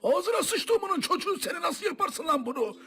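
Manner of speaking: angry scolding. Acted. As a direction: tear into the student. Teacher scolding the student.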